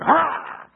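loud high yelp of surprise; startled yelp. Retold or imitated. Jogger startled when the dog snaps at him, yelp. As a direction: jump back with a yelp.

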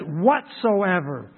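"whatsoever" slow and drawn out, each syllable weighed; stress on whatsoever. Shown as a demonstration. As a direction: lean on "whatsoever" slowly. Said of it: "I like the emphasis of the word whatsoever".

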